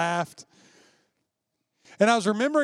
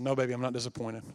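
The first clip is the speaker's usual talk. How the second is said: gentle grandmother; 7 semitones lower, 6 dB quieter than usual. Retold or imitated. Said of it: "She said".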